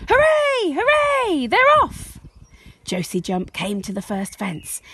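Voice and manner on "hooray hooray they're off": high, loud crowd hooray; crowd cheering. Acted. Crowd cheers the race start, high loud hooray. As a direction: cheer like a whole stadium. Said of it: "The crowd cheered".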